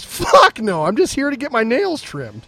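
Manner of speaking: high-pitched comic protest, punched at the start; indignant denial. Acted. Great Dane corrects the other dogs, indignant denial. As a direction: set them straight as the affronted dog.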